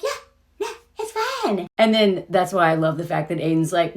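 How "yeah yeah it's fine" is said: higher and softer, falsely airy; high breezy brush-off. Retold or imitated. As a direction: Carrie pretending everything's fine. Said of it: "Carrie's like".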